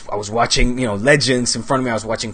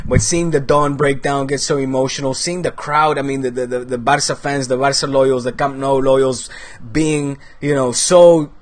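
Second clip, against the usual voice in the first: ordinary talk, nothing marked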